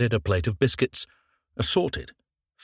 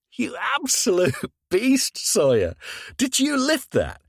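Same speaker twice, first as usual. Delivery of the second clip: laughing. Acted. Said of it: "He was laughing".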